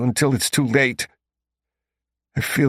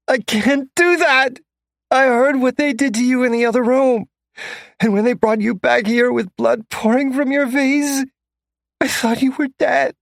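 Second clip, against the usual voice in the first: much higher, louder, distraught female voice; frantic young woman; 13 semitones higher, 5 dB louder than usual. A narrator's reading. Bridget terrified for her captive man, high and frantic. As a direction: plead in a high panicked woman's voice.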